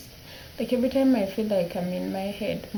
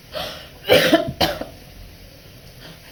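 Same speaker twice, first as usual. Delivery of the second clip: choked sob; 11 semitones higher, 5 dB louder than usual. Real, not acted. Crying on camera, choking up.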